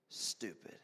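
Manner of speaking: whispered punchline word. Acted. Preacher ending on Esau's foolish trade, whispered word. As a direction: breathe the word out softly.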